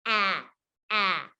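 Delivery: "ah" as the open vowel in cat; short, separate "ah" sounds, like the middle of cat. Shown as a demonstration. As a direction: say "ah" wide-mouthed with tongue flat. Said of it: "Short A says".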